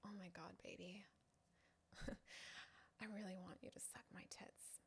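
breathy little come-on whispered quickly. Acted. Girl on a date coaxing her man, hushed and quick. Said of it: "and the girl shows you her tits and says".

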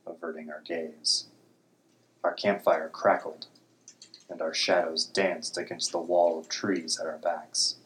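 ordinary delivery

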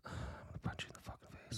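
hissed under the breath; whispered threat. Real, not acted. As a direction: mutter it through exasperation. Mock threat, exasperated.

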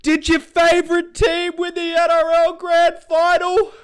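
high, loud mocking whine; mocking fan voice. Vlogger mocking voters, high loud whiny voice.

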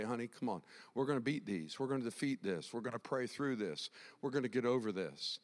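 put-on low, soft encouragement to a child; gently rally a frightened child; acted tender reassurance, voice dropped; reassuring parent.

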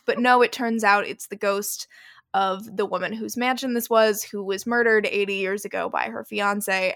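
ordinary talk

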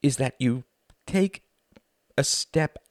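high, halting appeal with pauses; deliberate, broken-up plea; slowly. A narrator's reading. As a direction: measure out each small phrase. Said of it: "said Wendy slowly".

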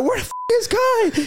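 high, laughing mock confusion; confused brother. Brother on the field, laughing and confused. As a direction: imitate the brother wondering where he is. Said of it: "He's like".

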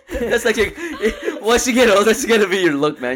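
high laughing tease. Real, not acted. Teasing about older look, laughing.

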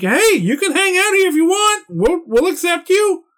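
high-pitched, welcoming skeleton voice; eager, high friendly-skeleton invitation. Acted. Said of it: "a bunch of friendly skeletons".

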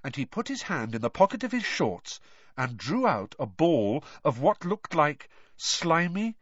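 ordinary delivery, nothing marked

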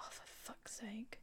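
slow, breathy whispered curse under the breath; exasperated whisper. Retold or imitated. Annoyed at the doctor, a slow whispered curse. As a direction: mutter it so he can't hear. Muttering about being sent away.

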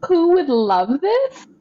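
high, slow proud puppy voice; lightly voiced eager pup; eager dog. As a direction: play the dog bursting with pride. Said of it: "was like".

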